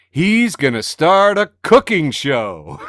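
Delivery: slow, loud, laughing reveal; laughing announcement. Real, not acted. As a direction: deliver the punchline laughing.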